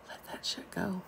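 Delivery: hushed whispered aside; confiding whisper to viewers. Real, not acted. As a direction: whisper it like a cheeky secret. Aside to the audience.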